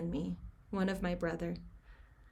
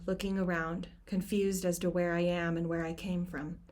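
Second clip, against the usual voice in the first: ordinary delivery, nothing marked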